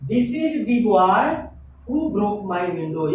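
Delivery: very slow, leaning on the as "thee"; emphatic the. Shown as a demonstration. Teacher demonstrating emphasis on this boy, very slowly. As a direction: spell out each word slowly, pointing at this boy. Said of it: "इस लड़के के ऊपर बल देकर".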